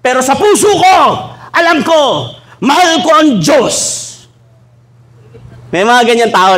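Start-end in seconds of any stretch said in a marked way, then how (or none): 0.0-3.7 high, slow, loud pious claim; mock sentimental poser. Preacher mocking a Facebook poster's pious claim, high and syrupy. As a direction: ham up a syrupy heartfelt declaration. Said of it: "nagpo-post pa sa Facebook".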